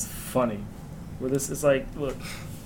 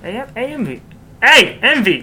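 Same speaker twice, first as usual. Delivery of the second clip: sudden higher, louder hey M V; playful shout; 8 semitones higher, 11 dB louder than usual. Acted. Mocking his speech among friends, a playful yell. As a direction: turn the letters into a loud greeting. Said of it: "mock pun on AMV".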